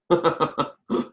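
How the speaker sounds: short, contained chuckle; brief chuckle. Shown as a demonstration. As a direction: give a small demonstrative chuckle. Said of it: "you know, chuckle or something".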